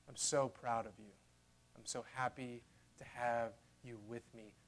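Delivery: soft, loving words spoken to the listener; tender father. Acted. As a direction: murmur it like a proud loving father. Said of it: "voice of the Father".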